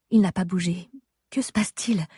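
ordinary delivery

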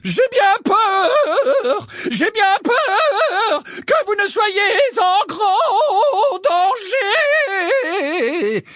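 very high, very slow doom-laden wail; milk every word like a prophecy; dramatic tone. A narrator's reading. Trelawney predicting danger for Umbridge, dramatic and very slow. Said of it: "d'un ton dramatique".

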